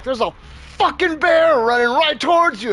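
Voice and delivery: high, urgent holler across a yard; retold shouted warning. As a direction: re-enact yelling a warning to the neighbor. Shouted warning to distant neighbour.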